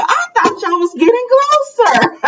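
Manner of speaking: very high-pitched, puzzled exclamation; baffled, voice way up high; incredulous reaction. Retold or imitated. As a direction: squeal it in disbelief, half laughing. Her shocked reaction to the plot.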